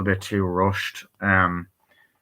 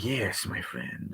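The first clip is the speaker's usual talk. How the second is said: Antonio Banderas; 13 dB quieter, much slower than usual. Retold or imitated. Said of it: "Antonio Banderas was great".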